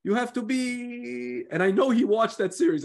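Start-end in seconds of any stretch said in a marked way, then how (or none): 0.0-1.4 slow, much higher Italian voice with a pause; Italian manager holding back a swear word; Italian accent. Retold or imitated. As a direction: drag it out like an Italian coach. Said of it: "then he pauses and he's like".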